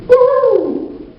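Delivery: single very high siren whoop; quick mock siren. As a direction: yelp it like a squad car siren. Same cop returns.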